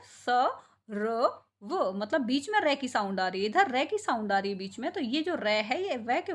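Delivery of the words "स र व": सर्व split into "sa... ra... va", each sound apart. Shown as a demonstration. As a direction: spell out सर्व slowly by sound, र in the middle. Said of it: "ध्यान से आप पढ़ो". (सर्व की हर ध्वनि अलग, "स... र... व")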